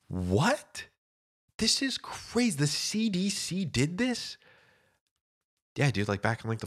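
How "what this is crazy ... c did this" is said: shocked disbelief. Retold or imitated. Retold shocked reaction.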